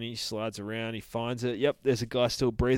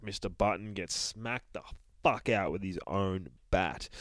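normal talking voice

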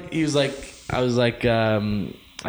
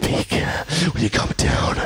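usual speaking voice

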